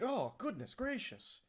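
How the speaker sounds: high, slow, prissy gasp of a shocked mother; a hammed-up prim mom, clutching her pearls. Mother shocked by her possessed son, high and prissy. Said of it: "she's like".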